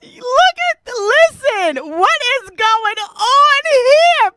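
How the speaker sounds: can't get the words out for laughing; laughing disbelief. Real, not acted. Reacting to clip, laughing.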